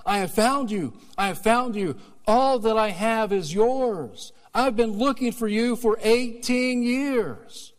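high, loud, slow joyful cry; father's cry. Retold or imitated. Father finding his lost son, joyful high cry. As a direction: embrace the lost son in your voice. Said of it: "the father cried".

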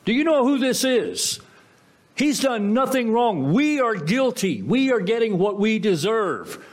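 high, urgent, pleading rebuke. Retold or imitated. Repentant thief rebukes the mocking thief, high and urgent. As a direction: shake him with it: we are guilty.

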